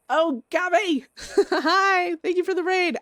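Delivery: high, loud, delighted hello; bubbly loud thanks; excited greeting. Real, not acted. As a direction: greet the raid with a burst of joy. Greeting raiders on stream.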